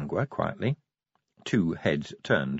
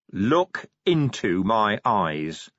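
each word slow and deliberate; slow and steady; 3 dB louder, much slower than usual. Acted. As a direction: hold his gaze, lay out every word. Vimes stares down Ardent.

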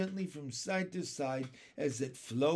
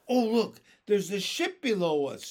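put-on loud, higher-pitched boyish call of discovery; excited shout; 4 semitones higher, 8 dB louder than usual. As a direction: shout it out to the others. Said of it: "shouted James".

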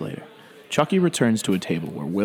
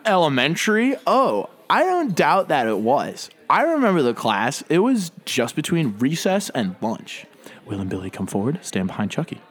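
smug preppy voice; 5 semitones higher than usual. Acted. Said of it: "Harvard preppy douchebag".

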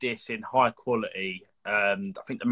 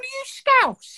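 very high, put-on Scouse accent; teasing Scouse voice, louder; mock Scouse question; 5 dB louder, slower than usual. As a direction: ask it in a squeaky Scouse voice. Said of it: "I went".

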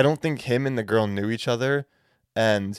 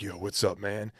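deepened voice; 2 semitones lower, 8 dB quieter than usual. Retold or imitated. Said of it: "deepened his voice".

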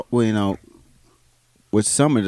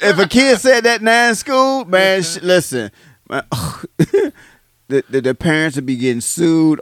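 excited; 9 semitones higher, 6 dB louder than usual. Real, not acted. Animated rant about kids now.

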